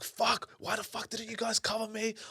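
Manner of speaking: high-pitched raging rant, re-enacted; retold raging. Goalkeeper blaming his defenders after conceding, retold high-pitched rage. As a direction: act out an exasperated goalkeeper's complaint. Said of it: "I was raging it at everyone".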